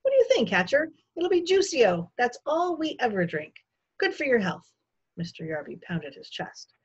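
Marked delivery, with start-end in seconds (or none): 0.0-4.7 high, booming jovial guest; hearty guest. A narrator's reading. Guest tells his host what they drink, hearty and high. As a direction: play a hearty, self-satisfied visitor.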